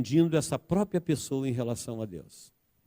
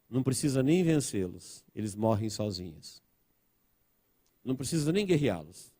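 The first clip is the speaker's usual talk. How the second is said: normal talking voice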